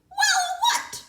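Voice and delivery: very high, loud, sly question; creature voice. A narrator's reading. Creature feigning ignorance to the piglet. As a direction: play innocent.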